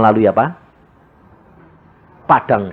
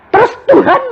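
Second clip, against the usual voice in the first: exclaiming; 6 dB louder than usual. Acted. Exclaiming to God.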